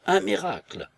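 high amazed exclamation; astonished cry. A narrator's reading. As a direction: blurt it out in disbelief. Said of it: "s'écria avec un accent de stupeur".

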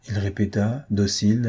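ordinary delivery

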